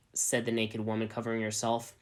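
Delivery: plain reading voice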